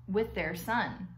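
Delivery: ordinary delivery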